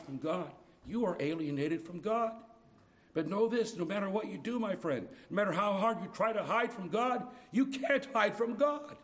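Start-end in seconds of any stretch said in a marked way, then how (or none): 3.1-9.0 high, loud climbing declaration; preaching at full force. Real, not acted. Preacher to the hiding sinner, rising to full force. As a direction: drive it home to the congregation.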